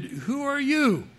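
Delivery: high, drawn-out challenge; heckler's slow, high-pitched demand. Acted. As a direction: demand it slowly, pitch jumping up. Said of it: "The heckler demanded".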